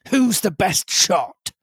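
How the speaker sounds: slow, loud commanding captain; sea captain. A narrator's reading. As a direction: ask it like a sea captain in charge. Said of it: "asked the captain".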